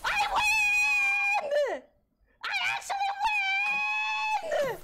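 very high, drawn-out victory shout; triumphant screaming. Real, not acted. Player beating Duke in the quiz, screaming triumph. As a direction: scream the gloat at full stretch.

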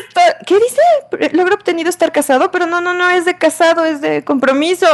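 excited. Real, not acted. Excited reading of achievement.

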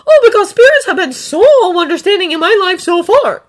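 high, loud sarcastic outburst; hysterical sarcasm. A narrator's reading. Boy answering that spirits will understand, sarcastic and slightly hysterical. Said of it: "might be a little bit hysterical".

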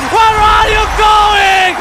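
mock taunt. Caster taunts fleeing hero.

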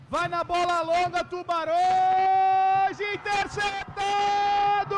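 loud, drawn-out commentator call; excited play call. Real, not acted. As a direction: build up and shout interceptado.

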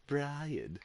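cartoon British baby accent; clipped posh cartoon baby; Stewie voice. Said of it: "in Stewie's accent".

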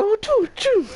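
high playful choo-choo noises; mock gun sounds. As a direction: imitate shots with a high voice. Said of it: "I was like".

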